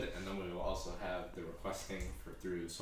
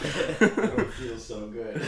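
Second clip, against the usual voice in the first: mock dying Spider-Man; 4 semitones higher, 10 dB louder, slower than usual. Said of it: "acting out the Spider-Man fall".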